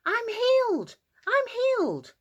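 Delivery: high, loud joyful shout; excited shouting. Acted. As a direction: shout the news across town. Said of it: "he ran into town shouting".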